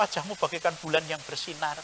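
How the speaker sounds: put-on soft romantic compliment from a young suitor; sweet courting words. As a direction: woo her tenderly under your breath. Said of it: "Ketika masih pacaran mengatakan".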